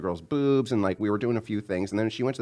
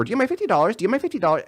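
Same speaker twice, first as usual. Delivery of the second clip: nagging singsong demand; 12 semitones higher, 4 dB louder, much faster than usual. Retold or imitated. Repeated demand.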